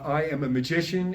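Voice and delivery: normal talking voice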